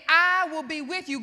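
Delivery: loud, preached declaration; God's emphatic promise. Retold or imitated. God answering a fearful Moses, loud and emphatic. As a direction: proclaim it forcefully, as God speaking. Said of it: "God was saying".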